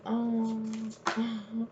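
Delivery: soft, hesitant mumbled reply; imitating a scolded child, small and soft; sheepish little child. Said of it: "They felt like a little child".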